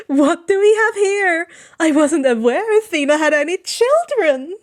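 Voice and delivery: high, loud cooing god. Acted. Hermes coos at Annabeth from the trees, high. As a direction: coo like a playful trickster. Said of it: "They coo".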